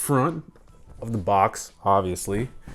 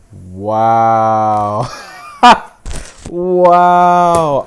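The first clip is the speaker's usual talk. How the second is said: excited; 11 dB louder than usual. Real, not acted. Thrilled at the new iMac.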